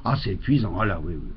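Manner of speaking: exhausted. Acted. Exhausted after the swingers' club.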